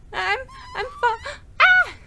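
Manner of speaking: high rising scream of falling. Acted. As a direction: cry out as you drop. Character falls screaming.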